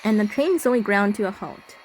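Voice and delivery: even, slightly hushed horror narration; story narration. A narrator's reading. Player reading the horror game's narration, slightly hushed. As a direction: read it like a creeping ghost story.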